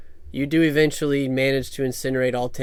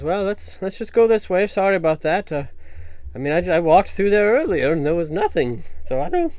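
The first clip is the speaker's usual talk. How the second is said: nervous NPC; 5 semitones higher, faster than usual. Acted. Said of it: "He says".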